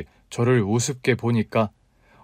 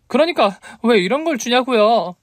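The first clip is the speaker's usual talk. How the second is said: very high, indignant heroine; exasperated outburst; 11 semitones higher, 4 dB louder than usual. A narrator's reading. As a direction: snap back in exasperation, pitched high. 손수건 문제로 따지는 여자. (답답해서 버럭 따지는 높은 목소리)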